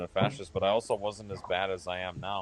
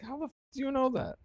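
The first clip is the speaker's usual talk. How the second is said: squeaky high disbelieving imitation; incredulous high mock voice; faster than usual. Retelling meeting his ex's cousins, high incredulous voice. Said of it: "I'm like".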